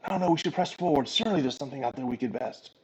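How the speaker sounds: lightly voiced eager shield, pressing onward; quiet, hurried urging from a small companion; Oddkel. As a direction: softly coax them to push on. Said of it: "you hear Oddkel goes".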